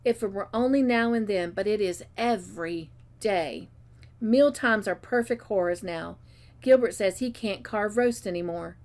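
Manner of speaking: ordinary delivery, nothing marked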